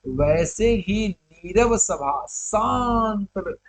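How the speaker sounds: very slow, drawn-out phrasing; poetic recitation. Acted. Teacher describes the silent assembly to students, slow poetic delivery. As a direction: hold the last word long.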